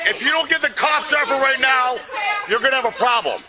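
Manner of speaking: loud, high shouted ultimatum; angry threat. Real, not acted. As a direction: shout it as a furious warning. Demanding police release his wife.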